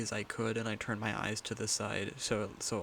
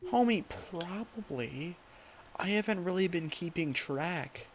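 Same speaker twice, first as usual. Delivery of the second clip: high joking voice; 7 semitones higher, 6 dB louder than usual. Pretend. Joking, raised bro voice.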